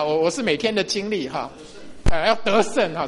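ordinary talk, nothing marked

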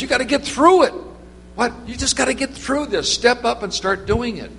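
high, insistent push to go on; pressing her to step up, pitched high; urging. Retold or imitated. Pastor urging reluctant prayer team.

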